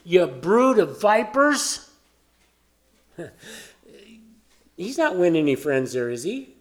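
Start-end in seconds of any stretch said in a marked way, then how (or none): 0.0-1.8 slow, higher, booming prophet rebuke; John denouncing. Retold or imitated. John calling out to the Pharisees, slow raised rebuke. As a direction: thunder it at them like John the Baptist. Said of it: "He goes".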